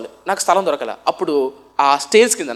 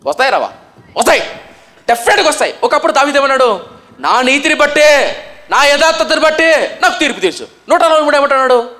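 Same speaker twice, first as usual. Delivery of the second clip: high, loud, fervent pulpit delivery; a rising, loud sermon cry; full-force preaching; 8 semitones higher, 6 dB louder than usual. Acted.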